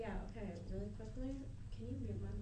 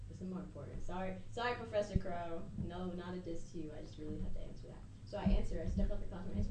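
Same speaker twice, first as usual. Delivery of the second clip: normal talking voice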